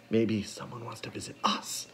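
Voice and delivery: soft unvoiced whisper; hopeless whisper. A narrator's reading. Klaus doubting the visitor, hopeless whisper. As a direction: whisper it without hope. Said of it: "without much hope".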